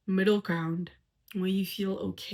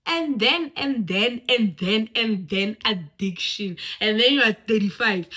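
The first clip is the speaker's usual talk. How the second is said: louder on each and then; building and then; 7 dB louder than usual. Real, not acted. Speaker to audience, stacking and-thens louder toward addiction. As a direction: stack the and-thens, climbing in volume.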